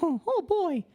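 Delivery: put-on very high squeaky Mickey "Oh, oh, boy"; Mickey Mouse impression. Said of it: "I have Mickey memorized".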